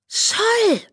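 sharp, high hissing shout; hissed cry. A narrator's reading. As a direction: spit the name out in alarm. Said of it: "fauchte sie".

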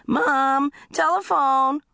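high-pitched holler for Mom; calling out. Acted. Child calling Mom to the telephone, high holler. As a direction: call through the house, drawn out.

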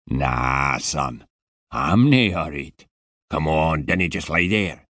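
put-on heavy Scottish accent, impatient and put out; a surly Scottish security man scolding; Scottish guard telling a boy to get up; grumpy Scottish guard. Scottish guard voiced in story.

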